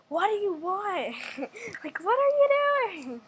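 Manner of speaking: loud, high, laughing taunt rising on doing; mock tough challenge. Friends taunting the staring big sister, mocking laughing challenge. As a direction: sass her with a giggle breaking through.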